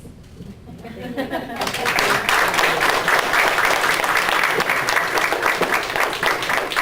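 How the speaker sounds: a long breathy laugh at herself; real teary laugh; emotional laugh. Real, not acted. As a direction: laugh off the tears. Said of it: "Sorry, I'm just an emotional".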